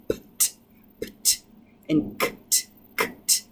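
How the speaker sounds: "p, t" and "k, t" said as bare puffs of air; unvoiced clusters. Shown as a demonstration. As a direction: tap out each pair of consonants crisply. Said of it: "You can hear an unvoiced matching".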